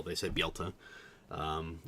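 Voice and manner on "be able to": "be able to" contracted to bee-ul-tuh. Shown as a demonstration. Streamer insisting on the tell, "be able to" as bee-ul-tuh. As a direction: lose the long a in "be able to". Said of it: "They don't even say the able".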